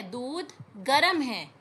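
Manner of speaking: ordinary talk, nothing marked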